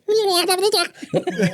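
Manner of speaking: very high, loud creature babble; gremlin gibbering. Retold or imitated. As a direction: gibber like a little gremlin sidekick, hammed-up. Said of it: "this weird little gremlin creature".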